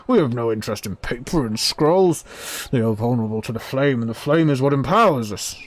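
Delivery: loud, indignant character voice; angry forge dweller. Acted. Forge dweller refusing paper and scrolls, loud and angry. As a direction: declaim the refusal with heated force. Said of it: "The voice comes back angry".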